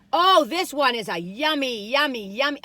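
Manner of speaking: loud, slow, delighted yummy; cooing over a tasty treat; excited yummy. Real, not acted. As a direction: savour each yummy out loud.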